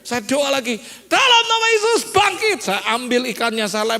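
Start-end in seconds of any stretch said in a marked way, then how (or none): 1.1-2.6 high, loud mock miracle command; acted desperate resurrection shout; forceful prayer command. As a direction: cry out the prayer at full force. Prays again, more forcefully.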